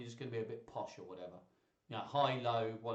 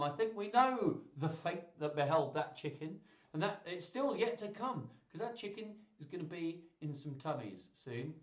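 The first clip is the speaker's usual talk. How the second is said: usual speaking voice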